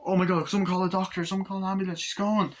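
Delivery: high, urgent cry for help; mock panic. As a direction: beg for help as a panicked onlooker. Woman dies, acted panic.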